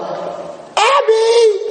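high, delighted call of her name; excited cry. Retold or imitated. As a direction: greet her with a surprised shout. Joyful recognition after turning around.

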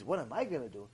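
low, soft musing; talking to himself. Retold or imitated. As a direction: quietly ponder to yourself. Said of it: "I said to myself".

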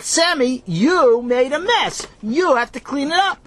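high, loud, indignant scold; upset scolding. A narrator's reading. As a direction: scold him like a tattling kid. Ellie upset, scolding Sammy.

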